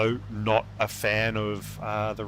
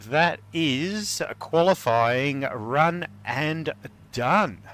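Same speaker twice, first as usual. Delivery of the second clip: rising, fast-building play-by-play call; live race commentary; 5 semitones higher than usual. Acted. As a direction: call the final laps with growing excitement. Live play-by-play of qualifying finish.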